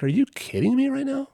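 high-pitched incredulous question; retold shocked officer; disbelief. Disbelief at trivial complaint.

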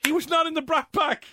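high excited shout; loud sharp voice. Real, not acted. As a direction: call it out high with sudden recognition.

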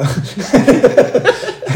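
high, helpless burst of laughter; giggling. Real, not acted. Podcast guest loses the thread of the story, giggling. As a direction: crack up mid-sentence.

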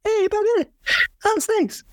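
very high, squeaky mock angel; mock voice from heaven, pitched very high; high heavenly voice. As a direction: chirp it way up like someone in heaven. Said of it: "up in heaven they're like".

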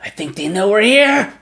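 hurry, tell Nomu they've been found; urgent whisper-shout. Acted. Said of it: "he goes".

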